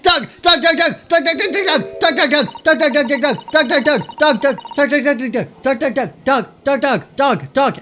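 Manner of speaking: shouted high, repeated dog-name chant; frantic loud calling; excited calling. Real, not acted. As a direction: yell the name over and over at the dog.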